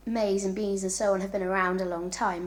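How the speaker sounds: usual speaking voice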